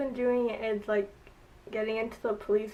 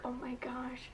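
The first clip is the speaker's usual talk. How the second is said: soft, much quieter whispered gasp; awed whisper; 10 dB quieter than usual. Retold or imitated. Admiring a clever detail in the show, whispered in awe. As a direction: breathe it out in awe. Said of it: "I was just like".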